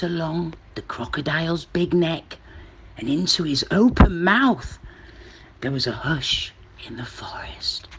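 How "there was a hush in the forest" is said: whispered suspenseful narration; hushed whisper. A narrator's reading. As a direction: drop to a whisper for the hush.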